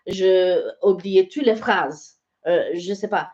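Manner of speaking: put-on retelling it in French-accented French; halting apology with a French accent. Customer apologising to a stallholder for forgotten French, French accent. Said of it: "I was speaking with a French accent".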